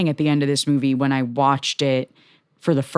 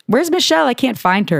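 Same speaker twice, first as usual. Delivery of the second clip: high, lost and puzzled; confused PA voice; 9 semitones higher than usual. Retold or imitated. Roommate acting lost on set, asking high and puzzled. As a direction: play the clueless assistant hunting for her boss. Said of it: "She was like".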